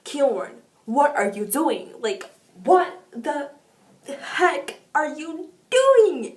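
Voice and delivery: loud, fed-up scolding at a book character; exasperated cursing. Retold or imitated. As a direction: yell at the page in disbelief, slowing on the last words. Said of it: "I was cursing at the book".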